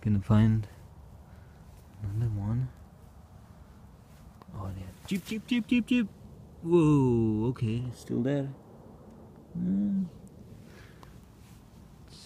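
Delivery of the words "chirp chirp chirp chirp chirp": very high, soft bird chirps; chirping like a bird. Pretend. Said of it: "when I chirp".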